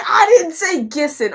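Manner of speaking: high, loud denial; indignant protest. Real, not acted. Streamer misheard on a name, indignant. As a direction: object loudly, pitched well up.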